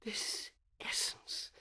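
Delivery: whispered disgust. Acted. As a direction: hush it to a whisper of shame. Villain's self-disgust in soliloquy.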